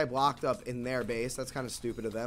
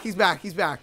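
normal talking voice

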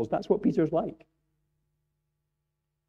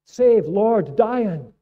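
high, loud cry for rescue; desperate shout; 9 semitones higher, 8 dB louder, much slower than usual. Retold or imitated. Disciples shouting to Jesus in the storm, desperate loud cry. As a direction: scream it like men drowning in a storm. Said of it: "That's what they say".

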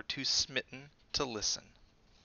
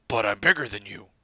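gruff bear; 10 dB louder than usual. A narrator's reading. Bear boasts.

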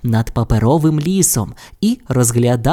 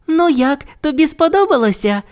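very high little character voice; high-pitched questioning voice; 14 semitones higher than usual. A narrator's reading. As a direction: ask eagerly, pitched way up. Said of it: "спитала Бу у панди".

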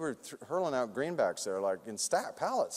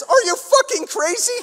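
high, loud, disbelieving shout; incredulous lawyer; 13 dB louder than usual. Retold or imitated. Lawyer yells at the producers, high incredulous shout. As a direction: blast it like an outraged lawyer.

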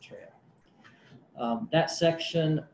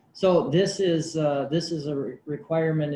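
speaking up; 3 semitones higher, 8 dB louder than usual. Real, not acted. Official restarts after being asked to speak up, louder. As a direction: raise your voice so the room can hear. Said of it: "I'll try to speak a little louder".

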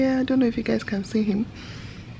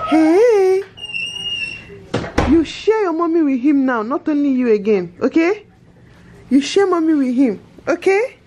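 joyful reunion; 8 semitones higher, 9 dB louder than usual. Real, not acted. Mother talks to toddler, excited.

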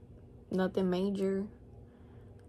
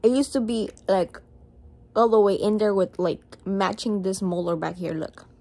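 pulling lip down while talking; speaking with mouth stretched open; finger in mouth; 7 dB louder than usual. Real, not acted. Seen 0: Finger hooks lower lip showing braces, released briefly near 5.6s.

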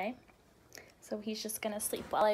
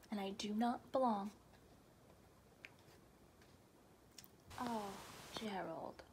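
sad and soft; 10 dB quieter than usual. Acted. Sad elephant, tender reply.

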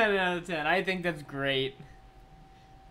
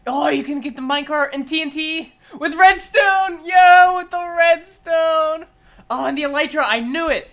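high, loud, amazed outbursts; excited shouting; 8 semitones higher, 11 dB louder than usual. Real, not acted. Fan watching the moves revealed, excited shouting. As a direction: shout each discovery as it appears. Thrilled by each revealed move.

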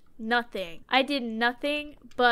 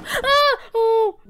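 high, loud startled shrieks; startled scream; 10 semitones higher, 7 dB louder than usual. Real, not acted. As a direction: scream in sudden fright. Startled by the game.

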